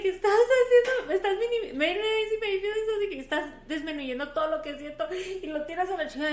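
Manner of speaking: high, loud mock meltdown; hammed-up distraught accusation; retold breakdown. Reenacting her breakdown at Luis, high mock-distraught protest. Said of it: "like, breakdown".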